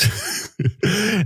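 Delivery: put-on high, wheezy old man cackle; falsetto old man's laugh. A narrator's reading.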